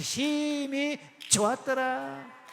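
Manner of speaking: 심히 stretched long on a high note; 심히 held high, then 좋았더라 drawn out; forceful 심히. Shown as a demonstration. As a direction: lead the crowd with a slow, drawn-out 심히. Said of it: "좀 힘있게 부딪혀서".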